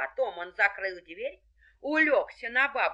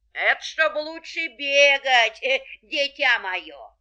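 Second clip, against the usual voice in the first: sly wolf laughing; 5 semitones higher, 9 dB louder than usual. A narrator's reading. Wolf answering as granny.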